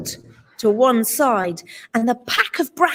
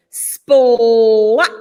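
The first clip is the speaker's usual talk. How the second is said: ordinary delivery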